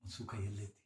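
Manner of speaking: soft, low asking; quiet question. Acted. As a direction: ask it almost under the breath. Preacher repeats question, then shouts. (ಮೆಲ್ಲಗೆ ಕೇಳುವ ಪ್ರಶ್ನೆ)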